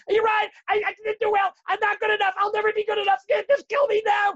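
high, loud wailing defeat; hammed-up despairing cry, slowing to the end; mock despair. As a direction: throw up your hands in melodramatic hopelessness. Acting a despairing, defeated inner voice.

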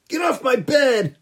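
put-on high, bratty kid yelling; whiny spoiled boy. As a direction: snap it like a spoiled child. Picky boy yells at sitter.

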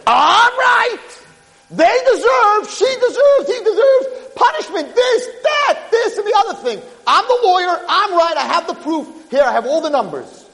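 high-pitched, insistent courtroom tirade; indignant accusing shout. Acted. Son demanding justice before Hashem, indignant high shout. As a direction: argue your case at full cry, voice way up.

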